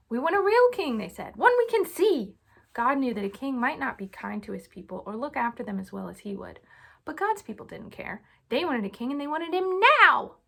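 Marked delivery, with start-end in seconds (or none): 0.1-1.0 high, loud insistent demand; demanding crowd. Acted. The people demanding a king from Samuel, loud and insistent. 1.3-2.4 high, loud insistent demand; demanding crowd. Acted. The people demanding a king from Samuel, loud and insistent. 9.1-10.4 impatient emphasis. A narrator's reading. Narrator stressing impatience.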